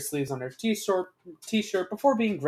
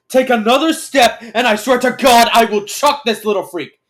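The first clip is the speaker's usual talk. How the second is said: loud, high threatening yell; shout; 7 semitones higher, 15 dB louder than usual. A narrator's reading. Alien threatens to chuck his hostage, shouting. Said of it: "it shouts".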